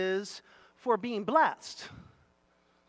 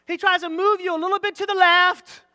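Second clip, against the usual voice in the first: raised, loud illustrative preaching; forceful preaching; 7 semitones higher, 8 dB louder than usual. Real, not acted. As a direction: drive the picture home to the congregation.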